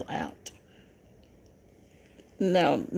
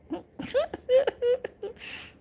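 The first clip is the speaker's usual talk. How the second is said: a wheezing, near-crying laugh; can't stop laughing at the chat; squealing laugh close to tears; high helpless laugh. Real, not acted.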